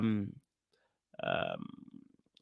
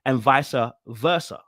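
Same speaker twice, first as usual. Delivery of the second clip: ordinary delivery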